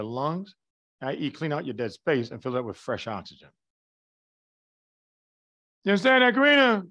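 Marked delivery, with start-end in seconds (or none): 5.8-6.8 loud, raised-pitch check on a student; calling out. Real, not acted. Lecturer checks that a student understands, calling out loudly. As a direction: call out louder and higher to one student.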